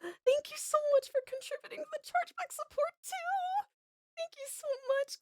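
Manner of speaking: high-pitched, near tears with joy; high tearful gushing. Real, not acted. Streamer thanking Patricia for her donation, high tearful gush. Surprised by donor, emotional thanks.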